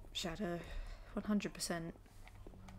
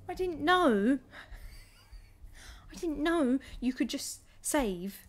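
astonished realization; 6 semitones higher, 5 dB louder, slower than usual. Real, not acted. Realizing save mechanic.

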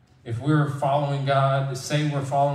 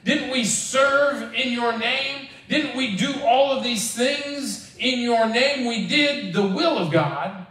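high, drawn-out indignant appeal; pleading protest; 10 semitones higher, slower than usual. Acted. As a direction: plead your case, pitched high and slow. Acted protest of the rejected before Jesus.

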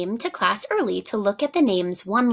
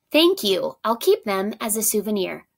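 ordinary delivery

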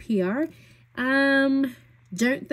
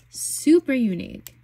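higher, louder delight; excited emphasis; 5 semitones higher, 6 dB louder than usual. Real, not acted. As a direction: gush over the shade. Enthusing about a lipstick shade.

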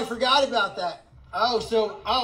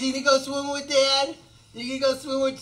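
higher, playful coaxing; pet talk; 5 semitones higher than usual. Real, not acted. Asking Katniss about swimming with Dad, playful pet talk. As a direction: talk to a pet in a raised sing-song question.

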